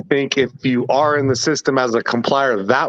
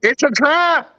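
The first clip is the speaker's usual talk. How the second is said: high-pitched comic put-on voice; mock warning; 14 semitones higher, slower than usual. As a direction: warn them in a silly high voice.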